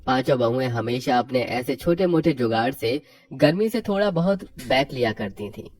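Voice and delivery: usual speaking voice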